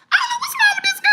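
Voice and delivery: squealing laugh-cry at Peach's antics. Real, not acted. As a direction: crack up in disbelief. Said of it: "I'm laughing because this is crazy".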